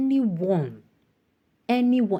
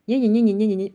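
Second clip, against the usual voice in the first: louder, higher rapid yeahs; mocking dismissal; 4 semitones higher, 6 dB louder, faster than usual. Real, not acted. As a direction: brush it off sarcastically.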